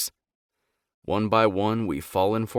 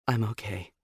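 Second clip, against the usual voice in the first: soft, unsteady, barely holding together; quiet wobbling reassurance; shaky voice; 4 dB quieter than usual. A narrator's reading. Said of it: "in a shaky voice".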